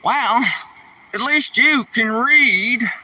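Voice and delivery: high, slow put-on bum voice; street bum quip. One bum ribbing the other in a high put-on voice. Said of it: "I hear the one bum say to the other".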